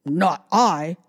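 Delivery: gruff dog voice; barking dog voice. Acted. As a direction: bark it like a dog. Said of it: "barked the dog".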